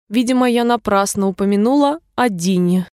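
plain reading voice

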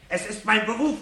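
high, loud, cold challenge; defiant. Acted. Fireman defies the old professor while tearing pages, loud and defiant. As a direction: throw his authority in his face.

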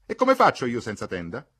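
dismayed protest. A narrator's reading. Mercedes protesting at losing her tent, loud dismay. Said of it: "con un grazioso gesto di costernazione".

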